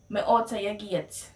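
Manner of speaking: relaxed Jamaican Patois line. Shown as a demonstration. Telling a friend she has arrived, in Jamaican Patois. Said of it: "how you would translate the words".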